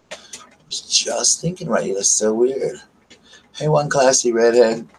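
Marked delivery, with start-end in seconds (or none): none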